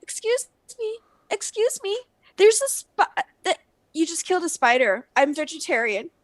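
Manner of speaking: high pleading voice; squeaky fussy protest; high mock plea. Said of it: "and I go".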